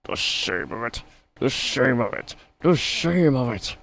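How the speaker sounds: hammed-up sulky engine; grumbling Gordon. A narrator's reading. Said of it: "he grumbled".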